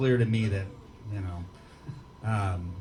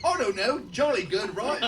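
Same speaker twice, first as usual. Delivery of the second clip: mock Cockney accent; 10 semitones higher than usual.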